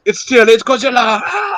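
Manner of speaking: high-pitched mock alarm with a little scream; mock cry of alarm; imitated movie panic. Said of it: "He's like".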